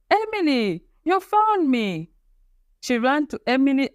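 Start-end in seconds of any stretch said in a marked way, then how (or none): 0.1-2.1 high, joyful call, slowing on the last words; a high, happy cry to her found sister; yelled. Acted. Girl cries out with joy on finding her twin Emily. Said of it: "She yelled".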